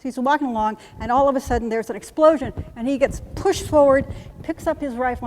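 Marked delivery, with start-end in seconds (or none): none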